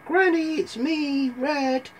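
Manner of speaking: put-on high girlish call; put-on high Red voice calling to Granny. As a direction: announce yourself in a high little-girl voice.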